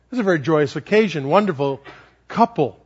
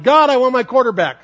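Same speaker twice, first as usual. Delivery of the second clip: put-on high, louder child's yell; 7 semitones higher, 5 dB louder than usual. Little girl yelling from the swing, high and louder. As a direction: shout it up to the sky from the swing. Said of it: "she was yelling".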